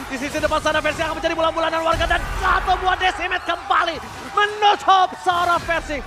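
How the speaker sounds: ordinary delivery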